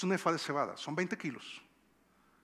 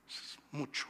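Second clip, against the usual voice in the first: breathy hushed "Es mucho", almost voiceless; whispered aside; 3 semitones lower, 10 dB quieter, slower than usual. Acted. Repeating the amount for emphasis.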